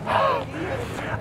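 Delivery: breathy exhale of relief; big relieved sigh. Shown as a demonstration. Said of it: "at the end you just go".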